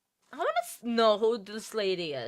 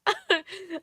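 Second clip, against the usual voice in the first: burst of laughter; 9 semitones higher than usual. Real, not acted. Laughs after apologizing.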